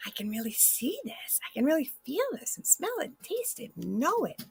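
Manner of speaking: marveling as it suddenly becomes real; awed realization. Acted. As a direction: whisper it in wonder, then let it rise. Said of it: "you're gonna sit there until suddenly you're like".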